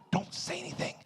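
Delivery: very soft warning whisper; hushed whisper. Acted. Audience members shushing each other, hushed whisper. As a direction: whisper it as if hiding it from him.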